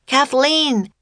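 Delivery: high call for help; yell. A narrator's reading. Jack calling his friend as the serpent looms, yelling. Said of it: "yelled Jack".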